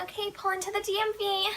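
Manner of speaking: high, soft mimicked instruction; examiner imitation. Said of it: "and then she's like".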